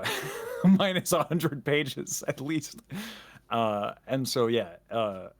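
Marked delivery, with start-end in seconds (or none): 0.0-2.8 laughing while admitting it; giggly, slow confession. Real, not acted. Laughing confession about long backstory.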